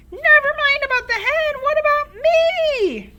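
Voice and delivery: Grandma shouting. A narrator's reading. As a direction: demand attention from the rooftop. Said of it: "Grandma shouted".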